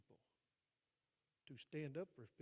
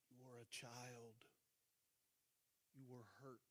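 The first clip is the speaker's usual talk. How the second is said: tender reassurance; 2 semitones lower, 7 dB quieter than usual. Retold or imitated. Consoling a friend who confessed her past, tender. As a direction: let each phrase settle gently, with long pauses.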